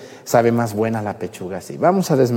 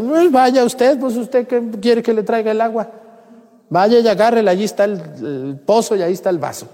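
much higher, louder, mocking a rude woman; rude retort; 10 semitones higher, 3 dB louder than usual. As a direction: snap back, put out. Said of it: "le hubiera dicho".

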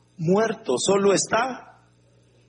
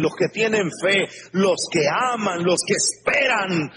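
usual speaking voice

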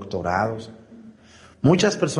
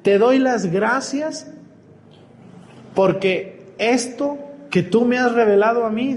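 slow, higher, fervent thanks; higher, slower, more fervent prayer of thanks; 9 semitones higher than usual. Retold or imitated. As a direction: lift the voice higher in awed gratitude. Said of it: "le dice".

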